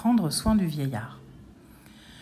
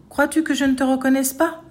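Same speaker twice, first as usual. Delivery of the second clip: indignant challenge; 6 semitones higher, 4 dB louder than usual. A narrator's reading. Old man challenges the ogre.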